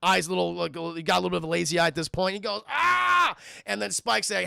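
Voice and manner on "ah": high, loud "ah" of pain; mock pain cry. Streamer as concussed Billy, mock yell.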